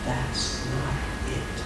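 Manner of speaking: hushed, slow and very soft; a near-silent inner voice; soft, slow divine voice. Retold or imitated. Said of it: "the Lord says".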